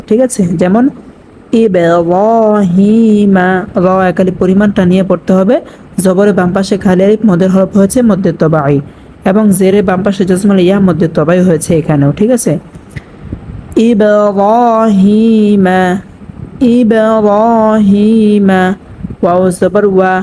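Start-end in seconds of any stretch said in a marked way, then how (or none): 1.6-3.5 ইব্রাহিমা chanted slowly with a bounce on ব; qalqalah recitation. Shown as a demonstration. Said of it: "কলকলা করিয়া একটু ধাক্কা দিয়ে পড়বো". 13.9-18.6 ইব্রাহিমা chanted slowly with a bounce on ব; qalqalah recitation. Shown as a demonstration. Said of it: "কলকলা করিয়া একটু ধাক্কা দিয়ে পড়বো".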